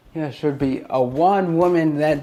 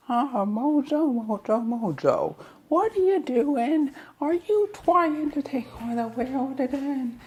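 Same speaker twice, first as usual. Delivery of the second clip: high mocking hero voice; 10 semitones higher, 3 dB quieter than usual. Hero teasing Mojo in a squeaky high mock voice. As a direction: tease him in a high, put-on voice.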